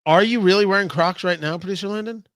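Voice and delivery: ordinary talk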